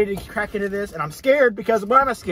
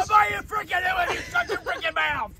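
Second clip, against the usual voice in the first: shouting; 11 semitones higher, 4 dB quieter than usual. Real, not acted. Yelling at friend.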